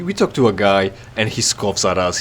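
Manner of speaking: ordinary talk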